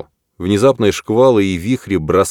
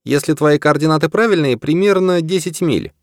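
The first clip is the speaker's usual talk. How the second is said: голос Дженни; 9 semitones higher than usual. Acted. Ответ Дженни.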